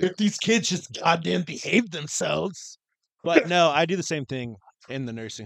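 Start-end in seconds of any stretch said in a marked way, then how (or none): 0.0-2.8 high, slow, drawn-out complaint; mock exasperation. Co-host joking about kids who won't behave, high exasperated whine. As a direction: grouse theatrically about unruly kids.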